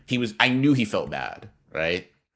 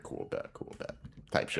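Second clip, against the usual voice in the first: near-whispered, much softer cool bet; under-the-breath aside; 13 dB quieter than usual. Real, not acted. Reacting to the cashier's offer, muttered very softly. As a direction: mutter it to yourself.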